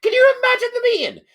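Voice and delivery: can you believe this, pitched right up; incredulous high outburst. Real, not acted. Host to co-host, marvelling at the absurd meeting, high and loud.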